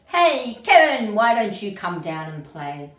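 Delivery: hearty, loud shout from below; shouted invitation. A narrator's reading. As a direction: call out loudly to a friend up a tree. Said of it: "shouted one day".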